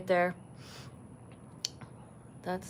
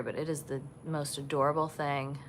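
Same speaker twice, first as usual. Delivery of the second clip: usual speaking voice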